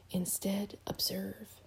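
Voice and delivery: soft, hushed whisper. A narrator's reading. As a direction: whisper it as a secret instruction. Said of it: "she whispered".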